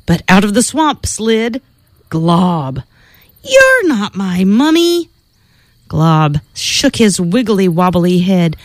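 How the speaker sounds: playful storybook narration with spooky sound words; reading to children. Acted. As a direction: read it like a bedtime story for kids. Reads Halloween picture book aloud.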